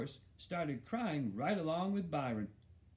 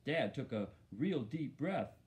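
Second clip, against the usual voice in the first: ordinary delivery, nothing marked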